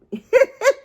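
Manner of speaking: squealing laugh at her own joke; a sudden high giggle; high burst of laughter. Real, not acted. Vlogger laughing at her own joke about birth weight.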